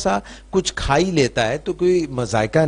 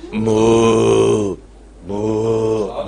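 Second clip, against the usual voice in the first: low mooing cow; 5 semitones lower, much slower than usual. Retold or imitated. Asked what the cow says, lower mooing moo. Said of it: "guy goes".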